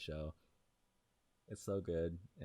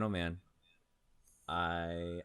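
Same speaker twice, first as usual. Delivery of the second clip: ordinary delivery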